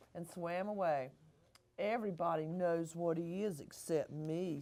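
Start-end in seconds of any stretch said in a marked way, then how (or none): 1.8-4.6 soft sad sigh. A narrator's reading. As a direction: sigh it out, feeling left out. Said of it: "sighed Beaky".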